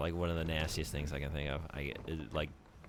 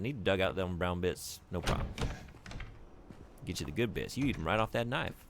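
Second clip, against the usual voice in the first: ordinary talk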